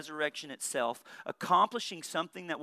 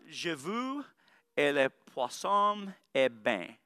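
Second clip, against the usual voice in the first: "Je veux et le poisson est bien" in a hammed-up Arkansas-flavoured French, slow and drawn out; mock French with a Southern accent; 3 semitones lower, slower than usual. Shown as a demonstration. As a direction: fake French phrase with a Saline County twang. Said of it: "Saline County accent trying to do French".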